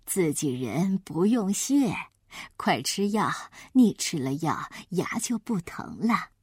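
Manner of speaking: low, soft, unhurried granny; kindly grandma. Acted. Grandma urges her grandchild to take medicine, low and soft. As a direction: coax the child like an old grandmother.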